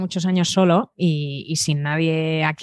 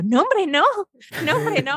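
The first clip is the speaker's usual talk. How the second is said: much higher, sweetly dismissive voice; polite reassurance; 11 semitones higher, slower than usual. Acted. Passenger answering an apology, hammed-up high politeness. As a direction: wave the apology away in a high voice.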